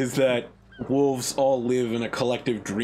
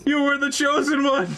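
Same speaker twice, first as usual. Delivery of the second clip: high, loud hammed-up lament; mock anguished wail; 5 dB louder than usual. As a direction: cry it out high like a heartbroken fan. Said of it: "And I'm like".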